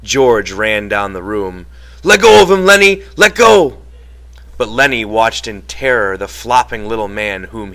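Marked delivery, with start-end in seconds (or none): 2.0-3.8 high, loud yelled command; urgent yell. A narrator's reading. George tries to stop Lenny crushing Curly, urgent yell. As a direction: yell urgently to break his grip.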